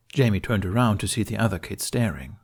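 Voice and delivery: plain reading voice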